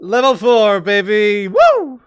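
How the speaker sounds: loud, high whoop of victory; excited cheering. Real, not acted. Player celebrating reaching level four, loud happy whoop. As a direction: celebrate like you just won.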